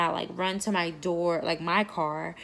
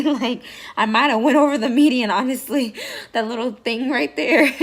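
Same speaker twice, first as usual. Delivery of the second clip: loud, higher, laughing through it; 6 semitones higher, 11 dB louder than usual. Real, not acted. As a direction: laugh out the memory of the escape. Laughing at escaping over median.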